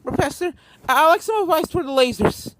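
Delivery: high, urgent little filly voice; young filly asking. A narrator's reading. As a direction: ask the professor for help, worried. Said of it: "talked again to the communicator".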